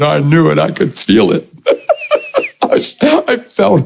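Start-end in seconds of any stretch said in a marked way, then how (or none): 1.7-2.6 high burst of laughter; a high, gleeful laugh; real laugh. Real, not acted. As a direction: laugh out in delight. Laughs at own holiness.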